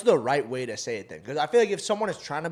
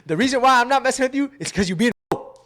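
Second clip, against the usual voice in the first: higher, louder, blunt matter-of-fact voice; a lightly voiced blunt elder, higher and louder; 9 semitones higher, 8 dB louder than usual. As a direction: say it plain and frank like an elder.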